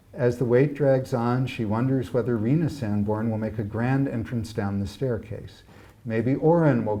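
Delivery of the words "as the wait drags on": ordinary delivery, nothing marked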